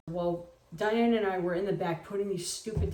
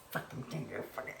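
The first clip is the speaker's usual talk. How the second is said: much softer, low muttered cursing; muttered curse; 10 semitones lower, 14 dB quieter than usual. Retold or imitated. Her struggling with the Santa head, muttering. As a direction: grumble at the stuck doll under your breath. Cursing under breath at doll.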